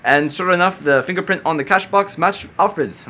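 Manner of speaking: hammed-up British accent on a news item; bad British accent. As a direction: try a clumsy British accent. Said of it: "I'll try to read this in a British accent".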